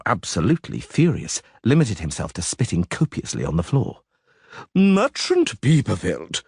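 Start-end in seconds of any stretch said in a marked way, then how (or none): 4.7-6.4 slow, high crowing address; lightly voiced crowing official. A narrator's reading. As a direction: crow the name pompously. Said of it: "Schwann crowed".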